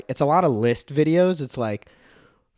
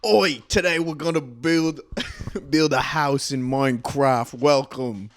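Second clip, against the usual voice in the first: mock British accent. Mock British YouTuber intro.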